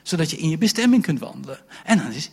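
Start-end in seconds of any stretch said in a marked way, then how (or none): none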